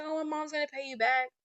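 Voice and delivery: put-on a teen brushing it off, high; nephew's excuse. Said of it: "He's like".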